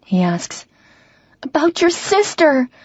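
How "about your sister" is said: hard, high-pitched retort. Acted. Woman answering River about his sister, hard and high. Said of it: "surprised by the hardness in my voice".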